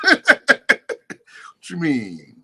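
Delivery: very slow, laughing through it; amused, drawn-out disbelief; laughing what you mean. Real, not acted. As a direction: drag it out while laughing. Laughing retort to a claim.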